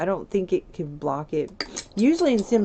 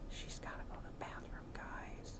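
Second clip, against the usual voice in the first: whisper; 20 dB quieter than usual. Real, not acted. Sim urgently needs the bathroom.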